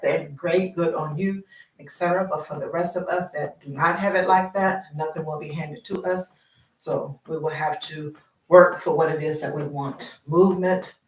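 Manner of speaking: ordinary delivery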